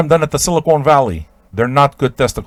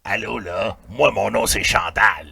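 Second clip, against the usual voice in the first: much lower, softer rasping Québécois voice; smoker's voice; 4 semitones lower, 5 dB quieter than usual. Retold or imitated. Imitating chain-smoking Chantal introducing herself, low and rasped. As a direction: croak it like a kid who smoked since five. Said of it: "So that's why she talks like that".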